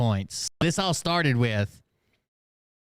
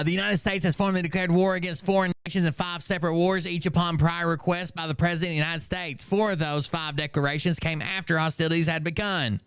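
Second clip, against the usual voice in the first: steady, slightly raised read-aloud voice; reading a post aloud; 6 semitones higher than usual. Acted. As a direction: recite his written response, evenly paced. Resumes reading the response aloud.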